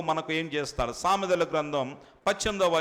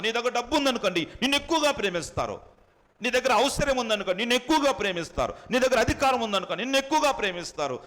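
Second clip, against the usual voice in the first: fast listing; 8 semitones higher, faster than usual. Real, not acted. Rapid listing of worldly love.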